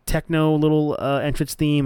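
usual speaking voice

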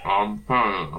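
talking through a mouthful of lunch; mouth full. Real, not acted. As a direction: say it while chewing food. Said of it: "Trying to eat my lunch".

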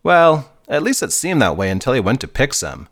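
usual speaking voice

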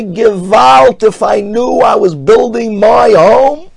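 high, loud Yiddish wail of regret; anguished cry. Acted.

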